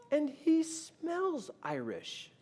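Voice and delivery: slow, high, drawn-out wonder; high voice, stretching each word; retold tearful wife. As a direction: imitate wife awed and weepy. Tearful wife adds odd detail.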